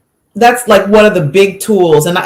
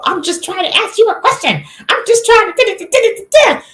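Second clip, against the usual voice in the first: high-pitched whiny kid imitation; mock a protesting child way up high, dissolving into da-da-da babble; upset child, mimicked. Mother mimicking her upset nine-year-old, high-pitched mock protest. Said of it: "he's upset because he's like".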